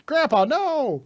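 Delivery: very high, loud mock cry; bad voice acting. Imitating the hero's dub line, shrill mock cry. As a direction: overwrought dub-style shriek. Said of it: "he delivers it like all his lines".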